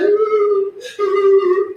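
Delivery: imitating a son's loud high wail; mock crying. Comic retelling her son crying at school, mock wail. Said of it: "crying real hard".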